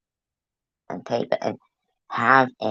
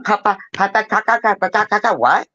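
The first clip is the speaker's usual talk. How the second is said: mock toddler voice; 9 semitones higher, faster than usual. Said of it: "She's like".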